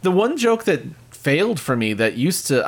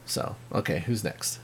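whispered aside; 7 semitones lower, 11 dB quieter than usual. Real, not acted. Awkward handoff, whispered aside.

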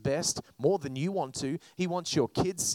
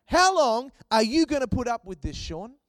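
stern challenge; 6 semitones higher, 5 dB louder than usual. Retold or imitated. Acts God confronting him.

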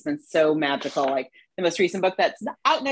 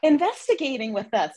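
normal talking voice

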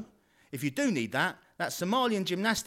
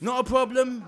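loud cheery catchphrase; 8 semitones higher, 6 dB louder than usual. Acted. Salesman catchphrase, louder.

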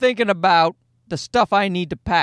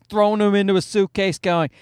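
ordinary delivery

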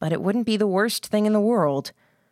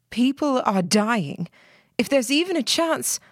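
fearful pleading; 7 semitones higher than usual. Acted. Emily pleading, afraid.

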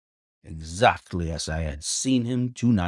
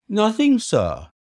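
high, louder, flustered servant; nervous high butler voice; agitated butler; 12 semitones higher, 5 dB louder than usual. A narrator's reading. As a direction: stammer it out, caught in the act. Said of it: "His agitation was so great that he could hardly speak".

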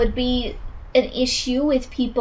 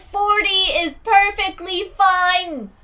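high, loud, slow mock American accent; American call; 6 semitones higher, 5 dB louder, much slower than usual. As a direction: holler it out like a caricature American. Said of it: "do an American call".

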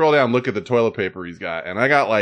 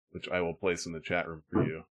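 normal talking voice

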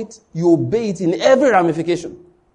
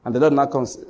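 ordinary delivery

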